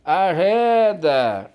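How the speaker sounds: loud, drawn-out driver's shout; shouted warning. Acted. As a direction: yell slowly to clear pedestrians out of the way. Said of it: "aos meus gritos".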